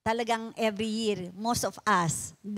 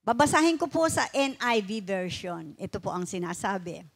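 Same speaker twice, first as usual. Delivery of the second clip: normal talking voice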